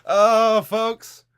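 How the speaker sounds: big high shout to the audience; loud announcer call. Real, not acted. Host shouting to his stream viewers.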